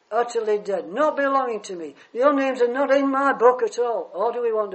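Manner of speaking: full-force preacher building to a peak; preaching. Acted. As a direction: preach it out to the whole church.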